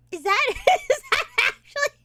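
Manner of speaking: high, loud, breaking into laughter; incredulous, words broken by laughs; laughing disbelief. Real, not acted. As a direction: crack up mid-question. Cracking up at the clip.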